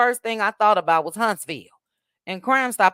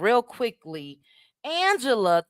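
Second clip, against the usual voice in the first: ordinary talk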